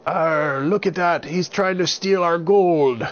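loud, high pirate shout; gruff pirate. Acted. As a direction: bellow like an angry pirate. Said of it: "And the pirate said".

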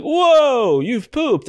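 high, loud startled whoa; a real jolt of surprise, then a grin; surprised whoa. Retold or imitated. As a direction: caught off guard by the smell. Smelling the baby's poop again.

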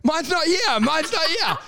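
high, urgent mock alarm; hammed-up passenger in a panic; panicked. Passenger finds no life jacket as plane goes down, panicked.

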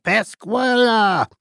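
high, slow, eerie call; drawn-out ghostly call of Pascuala; goblin calling a name. Retold or imitated. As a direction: sing out her name like a spooky spirit. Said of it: "the voice calling her by name".

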